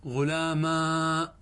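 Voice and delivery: غلاما stretched very slowly with a long final aa; drawn-out demo. Shown as a demonstration. Teacher listing the five forms, drawing out غلاما. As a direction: linger on the aa of غلاما. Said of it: "كم لك في غلام".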